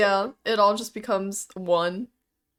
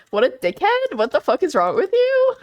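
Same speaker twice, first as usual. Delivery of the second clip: higher-pitched comic indignation; mock outrage; 8 semitones higher, 4 dB louder than usual. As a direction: scold in playful disbelief, voice climbing. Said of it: "And right now we're like".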